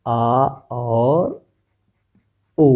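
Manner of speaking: आ drawn out very slowly; long vowel demo. Shown as a demonstration. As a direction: hold आ for a long time, slowly. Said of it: "इसी तरह". (आ को बहुत धीरे खींचकर)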